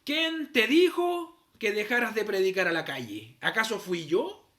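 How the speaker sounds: loud, slow stern challenge; booming divine reprimand; the Lord rebuking. Retold or imitated. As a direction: confront him as God would, slowly and forcefully. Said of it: "el Señor lo primero que le dice".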